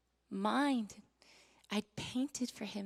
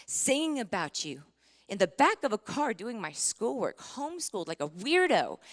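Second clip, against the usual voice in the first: rising, louder and higher outcry; angry at God; 5 semitones higher, 7 dB louder than usual. Retold or imitated. Mother recounting her fury at God, building outcry. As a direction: hurl the accusation upward, building. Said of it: "I was so mad at God. I said".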